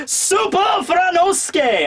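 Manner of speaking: very high, slow, stretched showman shout; hammed-up grand introduction; hype announcement. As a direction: announce him like a wrestler entering the ring. Announcing Frankie's alias.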